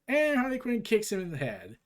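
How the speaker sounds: usual speaking voice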